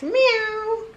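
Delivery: high playful meow; cat meow. Pretend. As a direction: meow like a kitten for the baby. Mom mimics cat for toddler.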